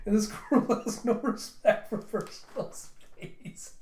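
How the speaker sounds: real laughter breaking through the words; high, slowed words dissolving into laughter; laughing. Real, not acted. Reader cracking up at the squirrel's lack of manners, laughing. Laughs while retelling comic scene.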